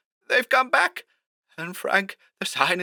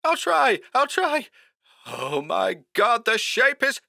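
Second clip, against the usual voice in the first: terrified. Acted. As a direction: gasp it out in panic. Terrified voice on phone, cut off.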